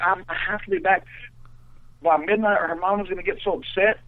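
high mock-worried girl; the girl's voice. Girl saying she must be back by midnight, high imitated voice. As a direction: pitch up into a put-on girl's voice. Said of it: "the girl's voice, uh, was hysterical".

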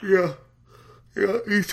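talking through a yawn. Real, not acted. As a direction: say it mid-yawn. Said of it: "I'm yawning over here".

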